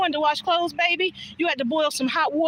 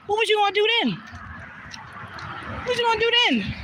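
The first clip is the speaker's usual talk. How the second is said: higher, pointed question; rhetorical challenge; 9 semitones higher, faster than usual. Real, not acted. Scolding spoiled car people, high pointed challenge.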